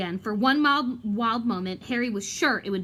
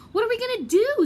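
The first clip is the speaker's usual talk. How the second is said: much higher, urgent; eager and alarmed; agitated; 12 semitones higher, 4 dB louder than usual. Acted. Ron panicking, eyes flashing.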